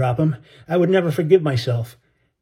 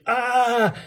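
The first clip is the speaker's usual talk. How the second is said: put-on sudden high child's cry; short high yelp of pain; 9 semitones higher than usual. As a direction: cry out as he hits the hull. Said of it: "he cried".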